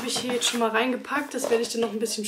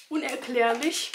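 ordinary talk

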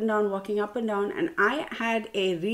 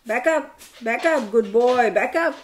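raised, repeated dog commands; commanding the dog; 5 dB louder than usual. Real, not acted. Owner to dog during a distraction, commanding. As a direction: call firmly to the dog.

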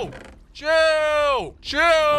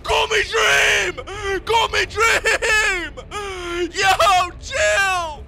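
loud, higher frantic shouts; panicked yelling; 7 semitones higher, 6 dB louder, much slower than usual. Real, not acted. Streamer hit by an unseen attacker, panicked yelling. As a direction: cry out in panic under attack. Taking damage from nowhere.

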